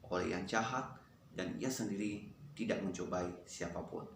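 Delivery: soft, low recitation; hushed, low read-through of a verse; quiet memorizing recitation. Real, not acted. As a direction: recite it softly to yourself, pitched low.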